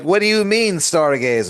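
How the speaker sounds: very high feigned innocence; mock innocent. Constellation feigning innocence to Aaron, very high. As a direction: play innocent.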